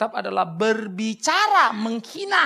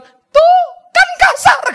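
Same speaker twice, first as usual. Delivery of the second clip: slow, high wailed accusation; loud imitation of a crying girlfriend; mock crying; 12 dB louder, much slower than usual. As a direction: shriek it tearfully, hammed up. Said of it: "Dia nangis".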